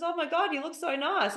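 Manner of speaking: high, mock-gushing admirer; gushing compliment. Someone complimenting you as you walk in, gushing.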